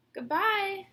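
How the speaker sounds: high, loud farewell call; calling out goodbye. A narrator's reading. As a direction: call it after them warmly. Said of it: "Missus Hartwell called after them".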